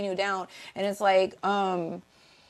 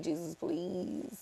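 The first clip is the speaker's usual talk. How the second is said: slow, much softer desperate plea; imitating a desperate prayer, slow and soft; mock begging; 3 semitones lower, 11 dB quieter, much slower than usual. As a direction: beg like someone on their knees. Quoting someone begging on knees.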